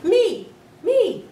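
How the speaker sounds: high, loud eager shout; children shouting for a turn. A narrator's reading. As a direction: kids yelling to be picked. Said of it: "the kids started shouting".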